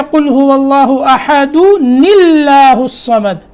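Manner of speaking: अहदु निल्लाहु joined with the n carried over; recited example. Shown as a demonstration. Teacher giving a familiar example of joining. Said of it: "वहाँ अहदुन अल्लाहु समद".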